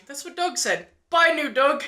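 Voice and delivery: high-pitched, put-on character voice; Korg. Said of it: "he's like".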